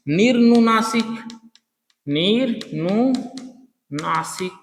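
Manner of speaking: निर्नुनासिक said slowly, syllable by syllable; slow drill. Shown as a demonstration. Teacher drills निर्नुनासिक slowly for students. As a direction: stretch निर्नुनासिक out so students repeat it. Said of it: "teacher drills the term".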